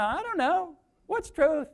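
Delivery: high-pitched mock bewilderment; mock clueless. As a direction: play someone throwing up their hands, pitched high. Voicing people who plead ignorance.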